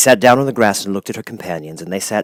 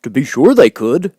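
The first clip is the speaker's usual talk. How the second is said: louder, higher eager scarecrow; eager cry; 6 semitones higher, 7 dB louder than usual. A narrator's reading. Scarecrow cheering the idea, loud eager cry. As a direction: cry it out, delighted. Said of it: "cried the Scarecrow".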